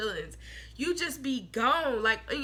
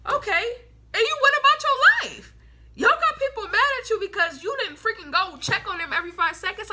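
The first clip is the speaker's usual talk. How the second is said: much higher and louder, fed-up exclamation; exasperated outburst; 8 semitones higher, 6 dB louder than usual. Real, not acted. Venting to Aquarius viewers about needy friends, exasperated loud outburst. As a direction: throw your hands up and let it out loud.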